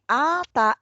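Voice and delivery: ordinary talk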